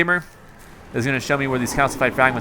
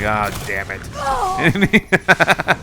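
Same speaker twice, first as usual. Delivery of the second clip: very slow, stretched, exasperated; drawn-out groan; 5 semitones higher, much slower than usual. Retold or imitated. Player groaning when teammates say no. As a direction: draw it out in weary defeat. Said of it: "And I'm like".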